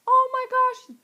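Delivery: squealing high and loud, thrilled fan; excited gush. Retold or imitated. Fan recalling her first reaction, high excited squeal. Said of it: "I still am like".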